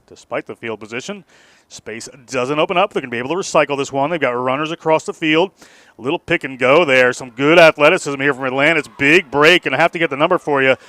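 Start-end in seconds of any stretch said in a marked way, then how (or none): 1.7-10.8 rising excited match call; live play-by-play. Real, not acted. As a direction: call the break as it happens.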